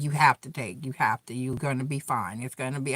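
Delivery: hushed inner voice calming her own worry; soft self-reassurance. Retold or imitated. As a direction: quietly talk yourself down, coaxing. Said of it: "all day I be like".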